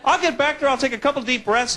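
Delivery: normal talking voice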